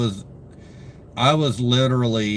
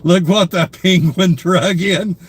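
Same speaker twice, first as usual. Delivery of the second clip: laughing; 7 semitones higher, 6 dB louder, slower than usual. Real, not acted. Laughing greeting to viewer.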